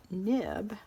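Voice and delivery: ordinary talk, nothing marked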